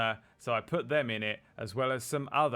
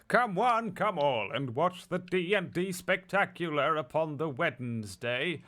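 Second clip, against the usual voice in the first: booming showman announcement; carnival barker; 5 semitones higher than usual. Acted. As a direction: roll up, roll up, showman style. Announcer calling out.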